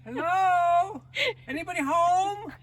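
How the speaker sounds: high, loud echoing call; calling into the dark. Pretend. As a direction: call out into an empty room. Calling into empty space.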